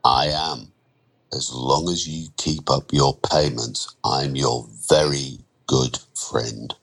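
low, menacing and deliberate; veiled threat. Acted. Lender quietly threatens his borrower.

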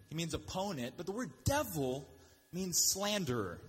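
usual speaking voice

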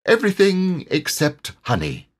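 high-pitched, breezy correction; high character voice, unbothered; self-assured tiger. A narrator's reading. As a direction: toss it off as a confident story tiger. Tigger corrects Pooh airily.

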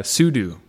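"sudo" said SOO-doo; second syllable of "sudo" rhymes with do. Shown as a demonstration. Host introducing a pedantic point, SOO-doo for "sudo". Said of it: "another pedantic pronunciation point".

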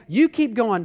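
ordinary talk, nothing marked

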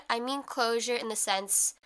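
ordinary talk

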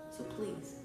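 usual speaking voice

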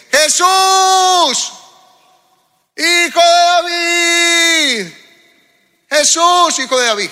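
grito muy agudo y lento; desesperado, clama al que pasa; clamor teatral. Acted. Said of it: "un poquito de teatro". (el ciego grita a Jesús, grito muy agudo y alargado)

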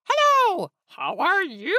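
very high, loud hello, swooping up on "you"; sing-song, hugely enthusiastic hello; over-eager practice greeting. A narrator's reading. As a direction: belt out a gushing, exaggerated greeting. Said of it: "He liked the last one best".

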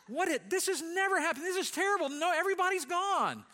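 high, loud, laughing panic; mock panic. Pastor alone at church, laughing high mock panic. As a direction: wail as if disaster struck. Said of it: "I'm going".

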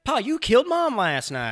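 high, loud hammed-up father; mock dad voice. Co-host joins the bit as the dad, high and loud. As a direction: play the dad, over the top.

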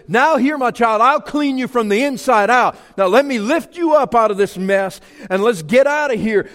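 high, loud, tender God calling out; God speaking. Retold or imitated. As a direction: lift the sinner up as God would. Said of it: "and He says".

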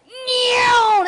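high squeal as she jumps; leap cry. Retold or imitated. Little girl leaping into the pool, high squealing cry.

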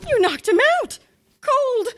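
high, stunned cry of alarm; horrified she blurts it out; shocked gasp. Acted. Teacher finds principal knocked out.

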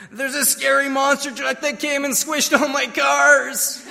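high-pitched imitation of an upset little boy; mock sobbing child. Son tells dad about his nightmare, hammed-up high tearful voice. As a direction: whine it comically like a tearful small son. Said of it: "between the sobs and the heaves".

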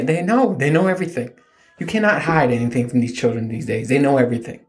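ordinary talk, nothing marked

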